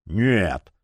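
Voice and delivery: low, baffled no; puzzled. A narrator's reading. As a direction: answer in puzzlement. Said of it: "озадаченно ответил главный фанатик".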